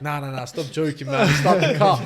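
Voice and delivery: loud, high shouting at the driver; panicked yell. Retold or imitated. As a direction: yell start the car in panic. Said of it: "they're like".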